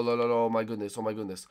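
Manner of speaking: normal talking voice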